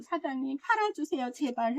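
quiet, pleading please-sell-it voice; soft begging plea. Retold or imitated. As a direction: beg the owner softly, hands together. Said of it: "해가지고 사갖고 왔어요".

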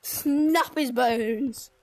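slow, loud, menacing. Pretend. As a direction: drag it out threateningly.

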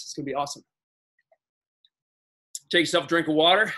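normal talking voice

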